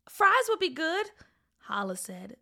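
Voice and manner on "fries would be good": higher-pitched lightly voiced boy; eager boy. Boy taking up dad's offer, mildly eager. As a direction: answer up high like a kid.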